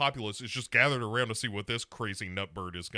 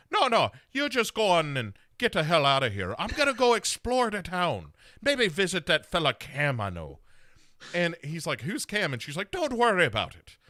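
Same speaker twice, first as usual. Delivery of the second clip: hammed-up Irish brogue, higher and louder; jokey podcast Irish accent, higher and louder; bad Irish accent; 7 semitones higher, 7 dB louder than usual. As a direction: play her with an over-the-top Irish accent. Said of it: "he's the one I inherited this Irish accent from".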